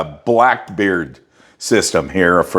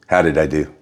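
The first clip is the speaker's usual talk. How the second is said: monotone voice; 3 semitones lower than usual. Shown as a demonstration. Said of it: "what I really worked on last time? Monotone voice".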